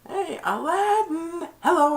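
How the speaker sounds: high cartoon monkey greeting. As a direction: chirp it like a tiny pet monkey. Abu greets Aladdin in retelling.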